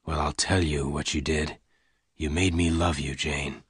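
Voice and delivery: low, soft, restrained confession; gunman confessing love. Acted. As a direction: admit love quietly in a low voice. Lassiter declares his love.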